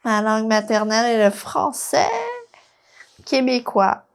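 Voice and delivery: quick, natural Québécois French for "Ma langue maternelle est le français québécois"; native Québécois French. Shown as a demonstration. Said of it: "my mother tongue is French".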